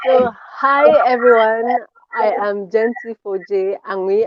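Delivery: ordinary delivery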